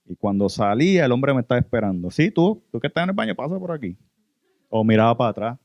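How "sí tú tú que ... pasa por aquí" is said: higher voice, calling someone over. Retold or imitated. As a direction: imitate the man calling him out of the bathroom. Imita al hombre llamándolo. (llamando a alguien en voz más aguda)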